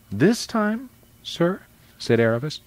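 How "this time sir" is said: timid question. A narrator's reading. Timid girl questions the lion.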